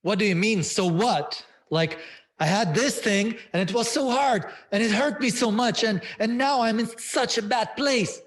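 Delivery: high, loud, shocked protest; shocked outburst. Retold or imitated. Client retelling her pain, shocked and upset. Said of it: "And she was shocked".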